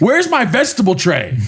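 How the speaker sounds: high, whiny husband demand; husband demanding snacks from wife, high and whiny; mock demanding husband. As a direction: whine it like a spoiled husband. Joining the husband bit.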